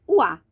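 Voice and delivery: loud classroom demo, oi sounds like wa; oi read as wa, loudly. Shown as a demonstration. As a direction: hit o A hard as the teacher's key sound. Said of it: "fonemas oi é lido".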